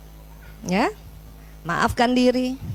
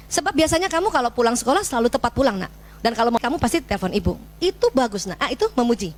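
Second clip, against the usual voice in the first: usual speaking voice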